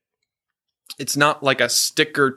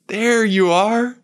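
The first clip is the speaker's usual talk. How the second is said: slow, high, wondering discovery; wondering recognition; 9 semitones higher, 3 dB louder, slower than usual. Retold or imitated. Lost Boy recognizes Peter, acted wonder. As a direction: draw it out high, as if finding a lost friend. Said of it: "one of the Lost Boys takes Peter's face".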